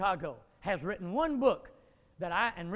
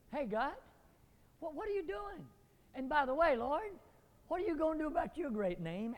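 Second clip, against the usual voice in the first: higher, softer, pleading to God; a hammed-up complaint at God, higher and hushed; mock whining blame; 7 semitones higher, 4 dB quieter than usual. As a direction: whine at God as if he owes you. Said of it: "he's trying to blame God".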